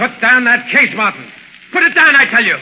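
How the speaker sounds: sharp, loud command to drop the case; angry shout. Acted. Burkett shouting at Martin to drop the food case. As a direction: bark it at him, losing patience.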